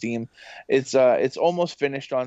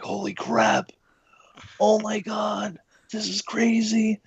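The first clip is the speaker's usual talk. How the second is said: amazed; 9 semitones higher, slower than usual. Retold or imitated. Hearing own voice in game.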